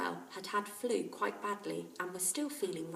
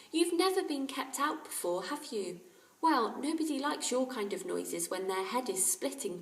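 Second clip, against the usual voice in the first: put-on higher young boy; boy's voice; 5 semitones higher, 4 dB louder than usual. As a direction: gently scold the parrot. Jack voiced in story.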